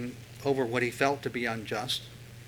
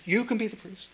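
doting mom; 7 semitones higher than usual. Retold or imitated. Mom answering son.

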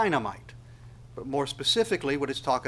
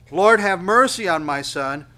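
pleading cry; 4 semitones higher, 9 dB louder than usual. Acted. Father's plea cried out loud.

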